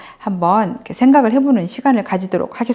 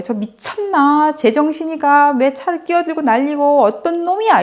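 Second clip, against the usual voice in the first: higher-pitched indignant rant, imitating an angry driver; retold angry outburst; 6 semitones higher than usual. Sister retold ranting at the car that cut in, indignant. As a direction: act out her road rage, pitched up. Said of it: "이 언니가 너무 화가 난 거예요".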